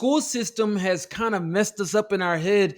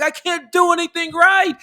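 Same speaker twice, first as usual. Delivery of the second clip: high, loud mock despair, a hint of the inner critic; defeated self-talk; 9 semitones higher, 7 dB louder than usual.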